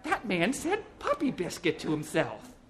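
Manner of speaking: tickled, drawn-out gossip; amused passer-by. A narrator's reading. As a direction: spell out the absurd thing to a friend. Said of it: "she said to her companion".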